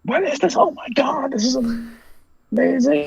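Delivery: high, gushing amazement; excited fan gush. Retold or imitated. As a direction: squeal it like a thrilled fan. Fans reacting to the cover.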